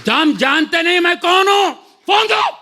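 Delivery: high, forceful yelled demand; shouting. Retold or imitated. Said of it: "मैं चिल्ला रहा हूँ". (फ़ोन के लिए ऊँची आवाज़ में चिल्लाना)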